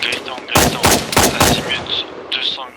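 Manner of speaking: interpeller fort. Real, not acted. Appelle un coéquipier en combat.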